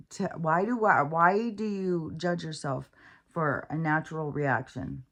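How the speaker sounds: ordinary delivery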